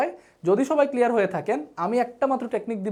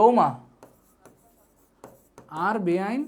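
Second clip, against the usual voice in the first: Noakhali dialect; slower than usual. Retold or imitated. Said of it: "একটু নোয়াখালী ভাষায় বলতেছে আর কি".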